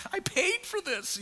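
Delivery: high, stretched-out wail; hammed-up tourist's protest; mock dismay. Retold protest of paying tourist.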